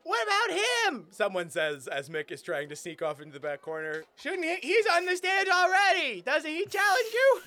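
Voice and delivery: high, eager heckler voice; heckler in crowd. Acted. Audience member pointing out Mick to Thing, eager. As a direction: point someone out with glee.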